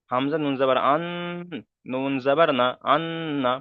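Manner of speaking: আন with the n held as a hum; with ghunnah. Shown as a demonstration. Teacher drilling ghunnah, a long hummed n in আন. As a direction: linger nasally on ন in আন, then না. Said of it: "সেই জন্য এখানে গণনা করতে হবে".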